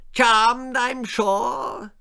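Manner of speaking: very high, loud affected drawl; condescending grand dame; posh wife feigning charm; screechy snooty lady. A narrator's reading. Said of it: "screeched Lady Davinia".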